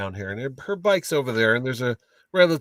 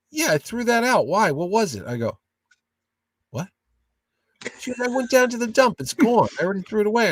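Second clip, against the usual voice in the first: a lightly voiced wife, higher and breezy; imitating wife, higher; 8 semitones higher than usual. Husband retells the wife casually admitting she threw it out, lightly voiced and higher. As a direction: play the unbothered wife, pitched higher.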